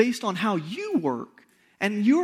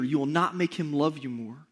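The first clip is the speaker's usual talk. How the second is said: ordinary talk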